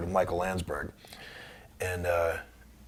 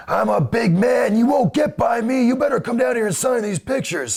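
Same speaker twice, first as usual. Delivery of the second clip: menacing caller; 14 semitones higher, 5 dB louder than usual. Retold or imitated. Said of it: "he goes".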